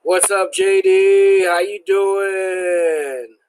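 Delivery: loud, high, slow greeting call; excited loud greeting. Real, not acted. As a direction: call out the hello with a big stretch.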